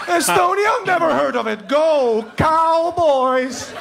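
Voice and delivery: mock dumb American. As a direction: play a clueless American fan. Pretending to be American.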